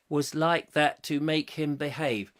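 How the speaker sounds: normal talking voice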